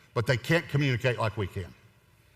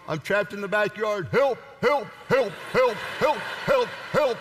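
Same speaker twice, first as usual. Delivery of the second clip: dog voice crying help; 8 semitones higher than usual. Retold or imitated. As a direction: yelp like a trapped dog. Voicing the dog's barks.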